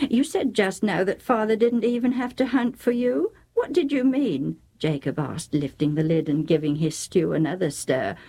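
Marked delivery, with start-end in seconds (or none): 0.1-4.6 higher-pitched, puzzled son; questioning son. Acted. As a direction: press mother for an explanation. Jacob questions his mother.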